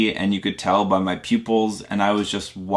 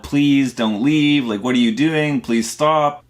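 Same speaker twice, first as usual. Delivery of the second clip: higher-pitched, half-raised mock yell; retold pleading yell; 5 semitones higher, 4 dB louder than usual. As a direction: plead as if calling after a departing cab. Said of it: "I started yelling at the cab".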